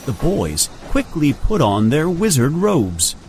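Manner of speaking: plain reading voice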